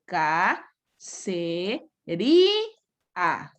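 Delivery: "ca, se, ri, a" spelled out one syllable at a time; teacher's slow syllable drill of "ca, se, ri, a". Shown as a demonstration. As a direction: break it into separate beats, ca-se-ri-a.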